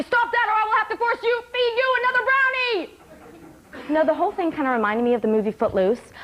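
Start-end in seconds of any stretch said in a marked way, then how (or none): 0.0-2.9 high-pitched barked threat; mock sergeant yelling. Psych tech catching a patient dancing, yelled threat. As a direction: yell it as a parody drill sergeant. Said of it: "Sergeant Psych Tech over here is yelling at me".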